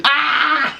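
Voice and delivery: high shriek breaking into laughter; shocked laughing shriek. Real, not acted. Shrieking and laughing at her own plant joke. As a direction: squeal then burst out laughing.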